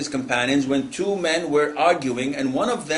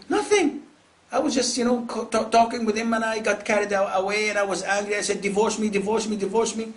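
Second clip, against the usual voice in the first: high-pitched imitation of a defensive wife; protesting wife; 7 semitones higher than usual. Wife explaining to the sheikh, mock-innocent protest. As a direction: plead innocence in a raised voice.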